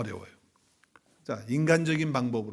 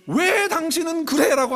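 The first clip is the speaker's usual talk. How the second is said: mock complaint; 13 semitones higher, 6 dB louder than usual. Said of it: "이게 나오는 거야".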